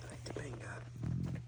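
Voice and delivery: slow, gentle whispered hello; soft whisper. Real, not acted. Greeting the timid Topanga in a slow hushed whisper. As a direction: breathe the greeting so she stays calm. Greeting a shy animal softly.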